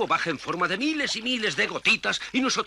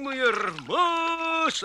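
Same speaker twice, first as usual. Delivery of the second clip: dreamy contentment; 6 semitones higher, much slower than usual. Acted.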